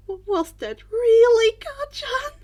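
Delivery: high, loud, slow stammering question; stunned disbelief. A narrator's reading. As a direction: ask it in shaky bewilderment. Confused boy after bully leaves.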